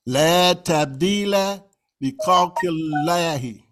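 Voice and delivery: slow, careful Arabic recitation; slow tajweed reading; Quranic recitation. Shown as a demonstration. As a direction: recite it slowly, letter by letter. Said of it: "notice I'm saying".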